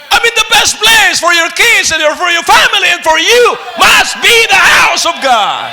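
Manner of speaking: high, loud preaching climax; preaching shout. Real, not acted. Preacher shouting to the church, loud and high. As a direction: belt it out to the congregation.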